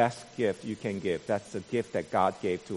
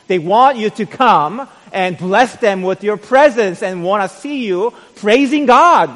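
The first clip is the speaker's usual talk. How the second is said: full-force preaching; 11 semitones higher, 14 dB louder than usual. Acted. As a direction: preach it out to the congregation, much louder.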